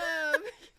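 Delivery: high choked laugh breaking into a sob; real teary laugh; tearful laugh-sob. Real, not acted. Guest sobs and laughs remembering her mom's song, tearful.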